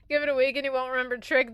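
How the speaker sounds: ordinary delivery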